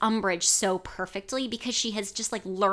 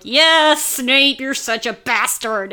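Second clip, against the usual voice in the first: loud, higher-pitched mock reader's cheer; gleeful cheering; 4 semitones higher, 8 dB louder, slower than usual. Host acts out readers cheering for Snape, gleeful cheering. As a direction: whoop it out in delight.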